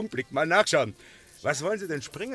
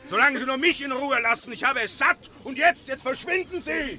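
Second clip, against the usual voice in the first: raised, higher angry rant; angry shouting; 6 semitones higher than usual. Acted. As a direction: drive the pest away in a temper. Dealer chasing off a pestering customer.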